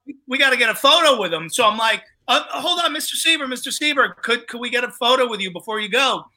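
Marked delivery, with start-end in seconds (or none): 2.3-6.2 eager pleading. Retold or imitated. Begging the star for a photo.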